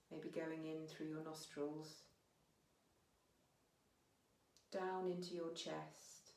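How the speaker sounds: unhurried meditation guiding with long pauses; guided meditation. Real, not acted. Teacher guiding listeners to notice their breath, calm meditation voice. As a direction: ease them gently inwards, phrase by phrase, leaving space.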